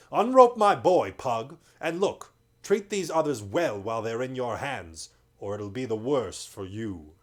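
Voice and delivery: loud lordly order; commanding lord. A narrator's reading. Lord ordering Pug to free the boy, loud and firm. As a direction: warn the trader firmly.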